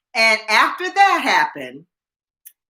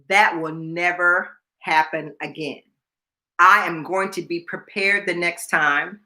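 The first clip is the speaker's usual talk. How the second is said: slow, low, deliberate resolve; firm vow; 4 semitones lower, 3 dB quieter than usual. Retold or imitated. Reporter vowing to herself after failed report, firm. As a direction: weigh each word, pitched down.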